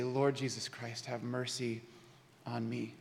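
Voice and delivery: very soft, low murmured prayer; hushed breath prayer. Acted. Preacher praying alone when stressed out, hushed and low. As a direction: breathe out, then pray it quietly and low. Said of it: "take a couple minutes and breathe".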